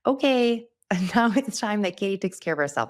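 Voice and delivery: high, loud, laughing mock announcement; mock relieved new year's resolve. Host mocking waiting until January to rest, with a high, laughing voice. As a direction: play up a belated self-care vow with a laugh. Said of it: "I do not wanna wait until January first to be like".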